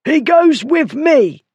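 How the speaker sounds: high, drawn-out hoarse shout; hoarse cry. A narrator's reading. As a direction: insist hoarsely at a high pitch. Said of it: "Bullard cried hoarsely".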